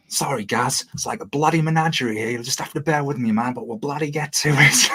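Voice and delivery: high, hammed-up Australian accent; bad Australian accent. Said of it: "really bad attempt at an Australian accent".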